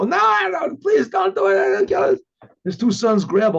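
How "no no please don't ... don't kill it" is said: high, shouted begging for mercy; panicked pleading. Retold or imitated. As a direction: beg for your life in a panic. Captives begging not to be killed.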